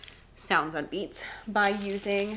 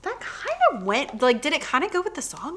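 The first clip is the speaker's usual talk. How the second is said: put-on higher, leading question to a child; coaxing teacher; 7 semitones higher than usual. Teacher gently guiding confused students. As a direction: nudge them toward the answer. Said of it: "I'll be like".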